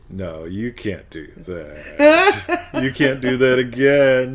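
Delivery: loud, drawn-out line through laughter; playful tonality change. Shown as a demonstration. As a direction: stretch it out, laughing. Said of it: "The tonality changes everything".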